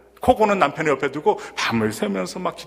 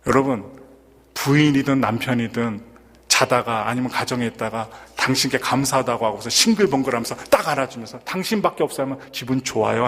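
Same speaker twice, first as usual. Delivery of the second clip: ordinary talk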